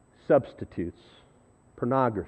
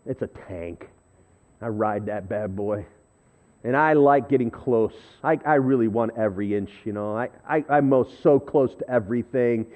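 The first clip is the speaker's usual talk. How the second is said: normal talking voice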